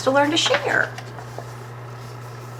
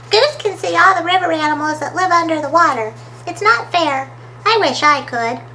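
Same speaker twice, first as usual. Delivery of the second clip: higher, slightly quicker story-character voice; Smudge the wishful character; 6 semitones higher than usual. Acted. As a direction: voice a little animal wishing for a turn, pitched up.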